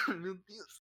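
strained after coughing; choking on a drink. Real, not acted.

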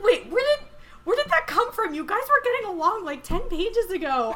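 high, loud, quick disbelief; baffled protest. Retold or imitated. Reader reacting to characters suddenly fighting, baffled protest. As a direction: protest as if totally blindsided.

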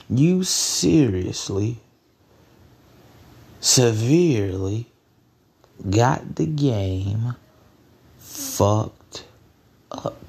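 very slow, word by word; long pauses between each chunk for weight; emphatic repetition. Real, not acted. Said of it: "say it just one more time".

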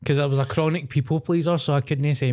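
ordinary delivery

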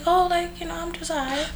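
high, soft and hushed; a breezy high little shrug of a voice; soft content sigh. Retold or imitated. About her big family, soft high contentment. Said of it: "it was just, like".

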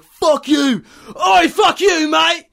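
high, loud furious shout; full-throated road-rage scream at another driver. Retold or imitated. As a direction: yell it at the top of your lungs. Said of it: "screaming at the top of my lungs".

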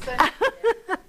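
short high laugh; a quick amused laugh. Real, not acted. Preacher laughs at the congregation's answer.

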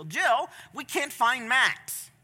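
lightly voiced child breaking bad news; worried child. Cousin tells Jill the boy is missing, worried child.

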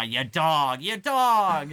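put-on rising drunk yell, "dog" shouted high; slurred drunk shouting "you dog"; drunken yell. Drunk Wake mumbling insults.

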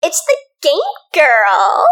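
put-on slow Valley Girl accent. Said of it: "Valley Girl accent".

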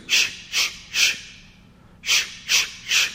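sharp hushed puffs in threes; voiceless quick breaths through labour; short panting breaths. Acted. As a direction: imitate labour breathing, "shh, shh, shh". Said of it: "all I hear Mary doing is".

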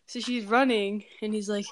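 ordinary delivery

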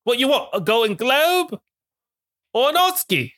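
hammed-up Cockney with pauses between phrases; Cockney-skewed impression. [name] urging Bradley on over FaceTime, in hammed-up Cockney. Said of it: "skewing a little bit Cockney".

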